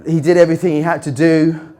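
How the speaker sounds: ordinary talk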